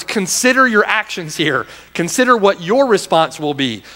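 normal talking voice

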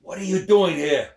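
high, loud demanding father; father confronting in the lodge. Acted. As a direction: demand an answer from him.

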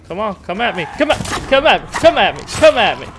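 loud, taunting yell building up; taunting shout. Real, not acted. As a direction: dare them to come, shouting. Taunting enemies in horror game.

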